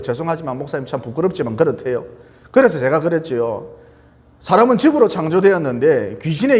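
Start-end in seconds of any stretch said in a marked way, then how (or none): 0.0-2.0 low, softened apologetic voice; retold in a small, abashed undertone; imitated sheepish admission. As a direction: voice a parent's embarrassed confession, dropped low and soft.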